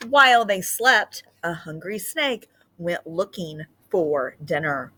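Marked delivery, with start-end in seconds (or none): none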